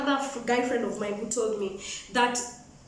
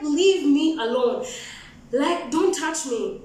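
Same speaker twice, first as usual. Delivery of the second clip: slow, spaced-out firm command; firm warning; 3 semitones higher, slower than usual. Retold or imitated. Woman to guy grabbing her arms, firm slow warning. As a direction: draw out each word, warning him off.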